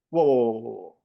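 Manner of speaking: put-on loud startled whoas from the old coach; shocked whoa. Old coach hearing about Facebook, shocked loud whoas. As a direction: recoil at the news, whoa after whoa. Coach reacting to social media.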